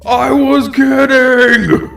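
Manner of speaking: high, drawn-out cry; panicked protest. Acted. Freddy's joke on Gregory backfires, panicked cry. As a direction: wail it in slow protest.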